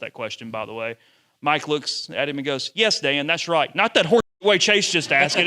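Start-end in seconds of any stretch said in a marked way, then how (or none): 2.7-5.5 louder, higher sarcastic coach swipe; mocking coach jab. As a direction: imitate a coach agreeing with one reporter to needle the other. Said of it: "he went back at me again".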